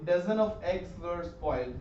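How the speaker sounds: reads the example slowly. Shown as a demonstration. Teacher reads the example slowly. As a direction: spell the sentence out slowly. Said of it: "इसको plural लिख सकते हैं".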